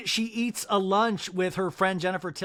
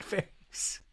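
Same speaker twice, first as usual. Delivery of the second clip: whispered; 9 dB quieter than usual. Retold or imitated. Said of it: "he's like".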